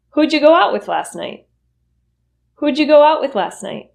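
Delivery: who'd you said as hooja, pitch lifted; reduced question. Shown as a demonstration. Teacher asking about last night, reduced. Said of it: "will reduce to whoja".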